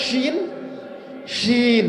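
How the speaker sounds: শিন with a long hissing sh hiss through the teeth; hissing shin demo. Shown as a demonstration. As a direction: stretch the শিন out as a sharp hiss between the teeth. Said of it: "শিন উচ্চারণ করেন".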